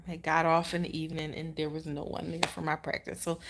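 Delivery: normal talking voice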